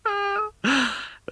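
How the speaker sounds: high, ringing burst of laughter; hearty laugh. A narrator's reading. Nephew laughing at his uncle, big hearty laugh. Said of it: "Laughed Scrooge's nephew".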